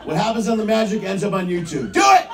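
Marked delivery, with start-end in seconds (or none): 1.9-2.3 sudden high, loud yell; shouted urging. Real, not acted. Comedian eggs audience on to hook up, shouted urging. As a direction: egg them on with a loud shout.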